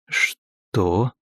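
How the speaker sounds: a stunned, breathy exhale; breathed out. Acted. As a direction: barely breathe the word. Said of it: "точнее выдохнул". (выдохнул, еле слышно)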